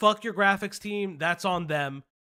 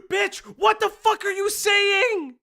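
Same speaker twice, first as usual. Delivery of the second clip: loud, higher, slow disbelieving yell; outraged shout; 12 semitones higher, 5 dB louder, slower than usual. Real, not acted. Outraged reaction to author's statement.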